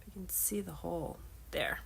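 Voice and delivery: soft whisper, no voice. Real, not acted. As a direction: whisper it like an aside. Close-up craft detail, aside.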